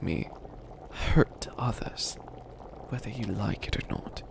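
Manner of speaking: whispered threat; menacing whisper. Acted. Villain whispering a threat to his new apprentice. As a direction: breathe the words like a secret menace.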